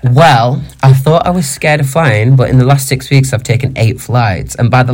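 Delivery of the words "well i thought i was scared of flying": usual speaking voice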